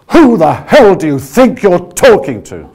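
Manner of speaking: loud, high, slow indignant outburst; angry retort. Shown as a demonstration. Coach showing an insulted, angry comeback. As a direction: hurl it back as if deeply insulted. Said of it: "I want you to say to me".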